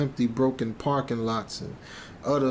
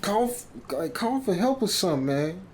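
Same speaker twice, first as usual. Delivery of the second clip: much higher, urgent retold panic; panicked question; 7 semitones higher than usual. Narrator retelling his panic to Rick over the injured kid, high frightened plea. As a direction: pitch it up like you're scared stiff. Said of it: "And I'm like".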